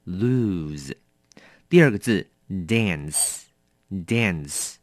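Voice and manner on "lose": "lose" said slowly with a buzzing z; careful pronunciation of lose. Shown as a demonstration. Teacher warning students, carefully sounding "lose". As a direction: model "lose" deliberately. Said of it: "要小心咯，它念成".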